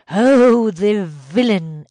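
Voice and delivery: loud outraged cry, slowly drawn out; indignant exclamation. A narrator's reading. Superintendent hears of Vasily's curse, indignant exclamation. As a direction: burst out loudly at the insult. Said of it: "exclaimed Michael".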